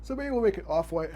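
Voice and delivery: words stretched by a yawn. Real, not acted. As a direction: talk through a yawn. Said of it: "am I sorry about yawning".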